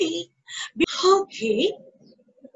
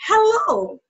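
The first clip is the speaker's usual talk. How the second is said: ordinary delivery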